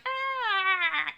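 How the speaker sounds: high exasperated mock yelp; frustrated cry. Describing a week of monkey wrenches, mock exasperated high cry. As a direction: throw up your hands with a high ah. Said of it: "and you're just like".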